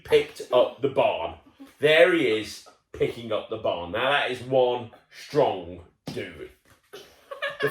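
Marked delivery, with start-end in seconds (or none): none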